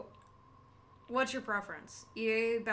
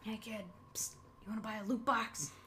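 hushed shady dealer pitch; secretive raspy sales murmur; 7 dB quieter, faster than usual. Acted. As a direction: murmur it like a street-corner dealer. Shady loot box dealer pitch.

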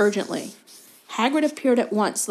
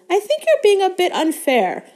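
high, loud, defensive protest; higher than usual; 11 semitones higher, 5 dB louder than usual. A narrator's reading. Hermione defending a teacher to Hagrid, high-pitched. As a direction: pitch it right up to object. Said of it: "in a voice somewhat higher than usual".

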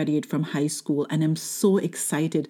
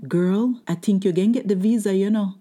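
higher-pitched, animated woman butting in; lady in line; 4 semitones higher than usual. Retold or imitated. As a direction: imitate the excited lady behind you in line. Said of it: "Let me interpret that".

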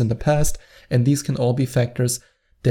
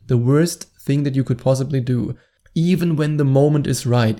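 ordinary talk

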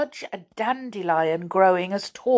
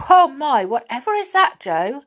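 alarmed; 7 semitones higher, 5 dB louder than usual. Acted. Alarmed at the shaking ground.

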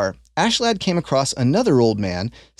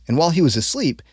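usual speaking voice

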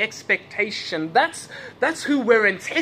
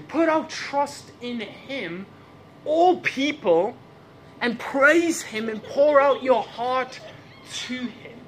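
slow, raised-pitch psalm recitation; stately, drawn-out Bible quotation; scripture declamation; 5 semitones higher, slower than usual. Acted. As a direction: proclaim the verse slowly to the congregation.

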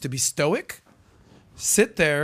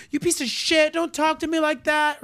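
much higher mock offended woman; upset woman; 11 semitones higher than usual. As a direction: imitate her indignant snap. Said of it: "she got so upset with the guy".

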